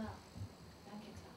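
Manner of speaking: slow, breathless whisper. Retold or imitated. A hurt believer telling God she's fine, whispered slowly.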